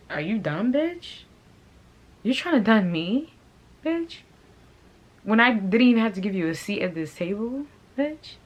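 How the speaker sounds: high-pitched incredulous challenge; indignant. Real, not acted. Woman confronting a former friend who turned on her, indignant. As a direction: fire off rising, outraged questions.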